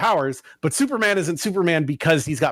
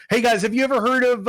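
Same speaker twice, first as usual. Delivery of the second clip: higher, brighter presenter pitch; upbeat restart; 7 semitones higher than usual. Retold or imitated. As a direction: restart it as a peppy host. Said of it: "let me, let me start over".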